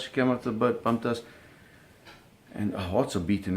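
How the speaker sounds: ordinary talk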